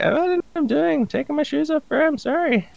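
high, meek panicky apology; flustered apology. Retold or imitated. Scared traveller apologizing to the shouting guard, high meek voice. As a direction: plead like a scared kid. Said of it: "I was like".

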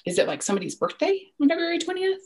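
imitating questioning son. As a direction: voice a curious kid guessing fast. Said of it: "he would continue to ask".

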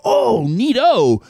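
dad telling his son a fire drill sounds neat, lightly acted; mock-casual dad reply. Breezy fake-cheerful neato. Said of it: "And I go".

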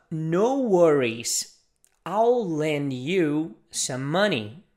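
very slow, clear English model sentence; slow teacher model; slow demo. Shown as a demonstration. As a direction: spell it out slowly for learners.

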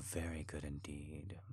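breathy whisper, no voice; hushed, pleased whisper; whispered praise. Acted. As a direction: whisper it close to the ear. Intimate praise after a gasp.

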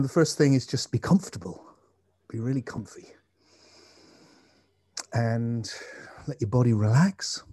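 slow, calm meditation guide; guided meditation. Acted. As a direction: gently guide attention to the breath.

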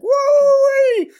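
loud, high-pitched "woo!"; excited whoop. Acted. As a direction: let out a big excited squeal of delight. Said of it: "I get excited, like".

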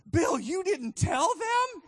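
high, loud, slow astonished call; wife calling from kitchen. Retold or imitated. Benny calls from the kitchen in shocked disbelief. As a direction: shout it in disbelief from another room. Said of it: "Benny says".

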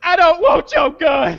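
high, slow, crying plea; a scared driver pleading with the cop through tears. Retold or imitated. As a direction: whimper it like a scared man begging. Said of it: "the guy's like".

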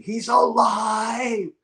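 high, slow ecstatic cry of joy; retold screaming. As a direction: shout it out slowly, high and overjoyed. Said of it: "And I'm screaming".

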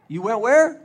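can't believe what you just heard; incredulous. Acted. Coworker hearing about church, loud and incredulous.

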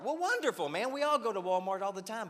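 very high, loud exasperated-sounding delight; mock enthusiasm. Pastor answering an excited churchgoer, loud high mock enthusiasm. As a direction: overdo the joy at dull news. Mock reply to trivial news.

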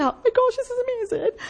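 high, breathless gush of wonder; gushing awe. Retold or imitated. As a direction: gush like you're moved to tears. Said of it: "I was like".

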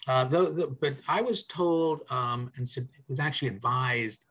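ordinary delivery

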